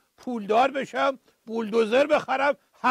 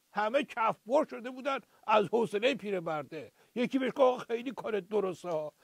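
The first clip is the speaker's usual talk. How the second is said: normal talking voice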